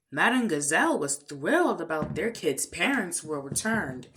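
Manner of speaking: ordinary delivery, nothing marked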